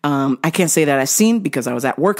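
ordinary talk

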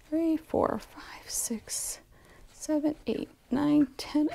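soft whispered count under the breath. Real, not acted. Quilter counting her fabrics, whispering. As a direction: count quietly to yourself, almost no voice.

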